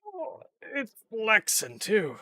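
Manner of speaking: high, slow alarmed exclaim; startled oh at the bend; surprised exclaim. Real, not acted. Sees chassis bend.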